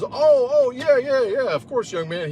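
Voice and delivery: put-on very high startled old man voice; flustered old man. Blind old man caught off guard by fist bump, high flustered voice. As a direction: squeak it out like a flustered old gent.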